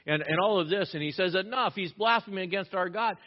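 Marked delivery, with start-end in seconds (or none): 1.3-3.2 high-pitched mock outrage; retold angry yell. High priest accuses Jesus of blasphemy, imitated outcry. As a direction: act out indignation, higher but not shouted. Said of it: "And he yells".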